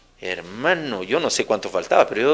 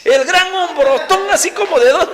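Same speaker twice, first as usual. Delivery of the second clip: amused; 5 dB louder than usual. Real, not acted. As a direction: tease the giant scared of a mouse. Mocking big man scared of mouse.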